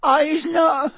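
wailing, very high terrified elf; slow, stretched squeals building to a cry; Winky. A narrator's reading. The elf pleads innocence to Mister Diggory, terrified wailing. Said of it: "her eyes rolling in terror".

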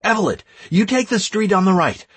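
high, urgent commands; calling out orders. A narrator's reading. Leader sending Evelyn through the houses, calling out orders.

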